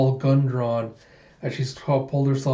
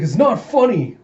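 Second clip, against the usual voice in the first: high, loud protest; acted indignant retort; 8 semitones higher, 5 dB louder than usual. Alita to laughing Bigot, high indignant snap. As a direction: snap back at being laughed at.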